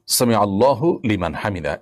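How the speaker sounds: সামি আল্লাহু লিমান হামিদা with হু sounded after আল্লা; correct pronunciation. Shown as a demonstration. Teacher showing the right recitation of the phrase. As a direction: say আল্লাহু fully, no stop on আল্লাহ. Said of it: "এটি হলো শব্দের সঠিক উচ্চারণ".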